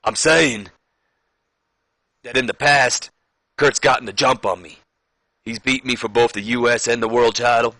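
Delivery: measured wrestler promo with put-on accent; badly faked promo voice, phrase by phrase; Booker T. Champion admitting past losses to his rival, put-on accent. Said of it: "stop with the accent".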